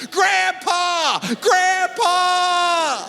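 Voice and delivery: high-pitched mock desperate cry, stretched out; imitated kid's long wailing yell; child yelling. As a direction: call for rescue like a panicked little boy. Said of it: "he starts yelling".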